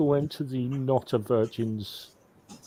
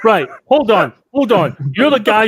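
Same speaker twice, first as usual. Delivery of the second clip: loud, higher call to stop; loud interruption; 9 semitones higher, 12 dB louder than usual. Real, not acted. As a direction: shout over the table. Player interrupting the table.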